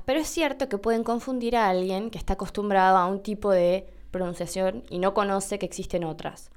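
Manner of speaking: ordinary delivery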